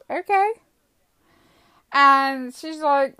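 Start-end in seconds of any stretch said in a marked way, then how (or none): 0.1-0.5 high, rising, tentative reply; puzzled agreement. Retold or imitated. Driver hearing they must call it in, puzzled. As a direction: go along with it, questioningly.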